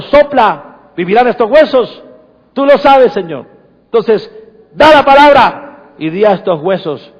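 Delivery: loud, high fervent declaration; preaching at full force. Acted. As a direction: call it out like a prophet. Preacher declares over congregation.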